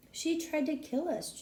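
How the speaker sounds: high, urgent shout. Acted. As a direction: yell out in alarm. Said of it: "Drew shouted".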